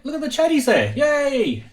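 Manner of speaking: high, playful cheering; excited cheer. Real, not acted. Host greeting viewers in the chat, cheering.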